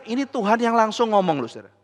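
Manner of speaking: ordinary talk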